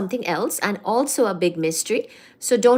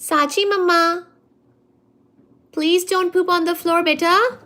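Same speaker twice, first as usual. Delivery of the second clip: higher, louder cooing at the cat; 7 semitones higher, 5 dB louder than usual. Real, not acted. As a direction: call the cat like a doting mother. Talking to her cat.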